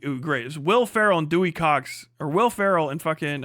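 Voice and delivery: usual speaking voice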